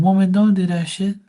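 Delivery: put-on a touch of a fed-up boyfriend yelling; exasperated scolding. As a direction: tell her off, half joking. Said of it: "I'm saying".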